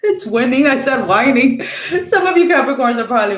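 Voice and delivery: high, laughing through the words; real amused laughter. Real, not acted. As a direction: crack up at your own slip. Cracking up at own misreading.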